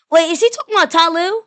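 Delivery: high, loud astonished question; startled realization. Real, not acted. Listener guessing who was meant, loudly startled. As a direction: blurt it out as it dawns on you.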